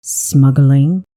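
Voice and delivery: low growled question. A narrator's reading. Questioning a smuggling report, growled.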